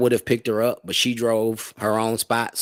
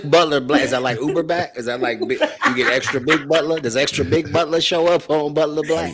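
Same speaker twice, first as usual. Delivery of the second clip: laughing; 5 semitones higher, 6 dB louder than usual. Real, not acted. Laughing while teasing Butler.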